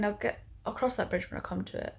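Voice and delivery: ordinary talk, nothing marked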